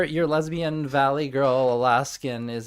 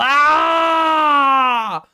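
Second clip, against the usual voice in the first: loud, high, drawn-out scream; long scream; 10 semitones higher, 7 dB louder than usual. Real, not acted. Streamer cut off mid-word on stream, long scream. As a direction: break off into a sustained yell.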